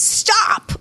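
high, sharp alarm call from a device; gadget warning voice. Pretend. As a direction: bark it like a nagging gadget.

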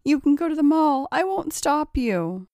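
loud, high-pitched pleading; rushed plea slowing at the end; begging. A narrator's reading. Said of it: "begged John".

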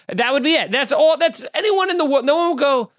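ordinary talk, nothing marked

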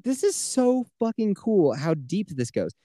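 amazed gushing. Retold or imitated. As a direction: savour every word like it blows your mind. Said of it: "And I'm just like".

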